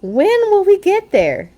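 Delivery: put-on very high, loud whining kid voices; impatient cubs. Cubs asking Papa from the back seat, high and loud. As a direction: whine it like bored cubs in the car.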